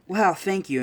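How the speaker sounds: lightly acted grateful exclamation; impressed thanks. Ochako thanking her rescuer, impressed. Retold character reacting with delight.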